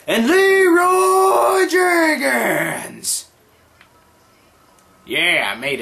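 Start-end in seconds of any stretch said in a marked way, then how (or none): 0.1-3.3 long high drawn-out yell; battle cry. Pretend. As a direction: charge in hollering the name. Charging in with the meme.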